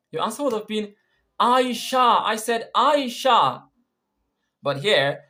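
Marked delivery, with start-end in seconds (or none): 1.4-3.7 high, loud, drawn-out "Aisha"; stress on Aisha. Shown as a demonstration. Answering someone who asked who plays, emphatic stress on "Aisha". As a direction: insist on "Aisha", punching it both times. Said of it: "Aisha was not stressed".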